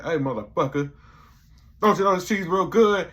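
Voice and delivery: loud and high, rising into a furious shout; angry yell. Retold or imitated. Arsenio yelling at a guest over the cheese, angry. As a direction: snap at him, then blow up. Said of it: "He's like".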